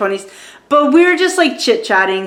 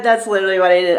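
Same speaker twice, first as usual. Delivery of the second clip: usual speaking voice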